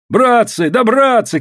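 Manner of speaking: high, urgent call to the crowd; shouted appeal. A narrator's reading. Сукнов wading into the brawl, high shouted appeal. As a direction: shout to them at a high pitch. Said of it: "кричал Сукнов".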